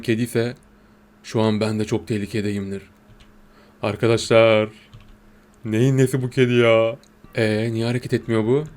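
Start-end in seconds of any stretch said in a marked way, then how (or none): none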